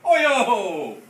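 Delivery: loud hollered reply. A narrator's reading. Martians hollering back in the tug, loud holler. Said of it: "the Martians hollered".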